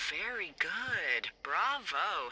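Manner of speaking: slow, drawn-out mock applause; teasing praise. Woman rewarding the new lookout's wild guess, teasing praise. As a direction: savour each word of the praise.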